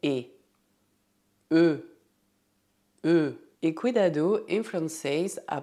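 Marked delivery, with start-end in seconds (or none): 1.5-3.3 E said with rounded lips, like Portuguese U; French E sound. Shown as a demonstration. Teacher shows students the rounded French E. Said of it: "você precisa fazer a boca do U".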